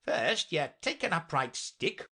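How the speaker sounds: high, slow, swooping knight; earnest knight. Acted. Knight teaches his hair plan, slow and earnest.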